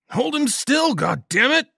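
high, loud screaming order. Acted. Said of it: "Someone screamed".

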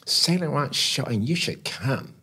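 high, stretched-out imitation; put-on high voice, coaxing a friend along; Pat Cleveland. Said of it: "and she said".

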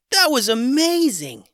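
put-on boy thrilled by the magic; amazed boy. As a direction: marvel at the magic.

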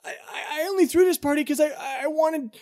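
high, fast hurt babble; tearful plea. Acted. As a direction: blurt out the confession. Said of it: "Richie's like".

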